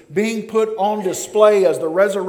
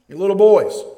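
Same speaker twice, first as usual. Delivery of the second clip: louder, teasing call to the disciples; voicing Jesus chiding his boys; mock Jesus; 7 dB louder than usual. Preacher voicing Jesus calling disciples little boys, teasing.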